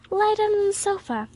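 high-pitched, concerned urging. A narrator's reading. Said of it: "urged Emily".

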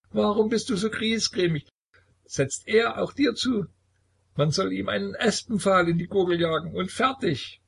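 sehr hohe, lallende Stimme; betrunken. A narrator's reading. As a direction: kindisch und unheimlich zugleich. Betrunkener Sohn spricht zum Vater. (sehr hohe betrunkene Kinderstimme)

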